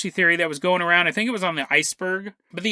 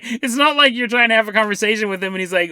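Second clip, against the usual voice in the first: ordinary talk, nothing marked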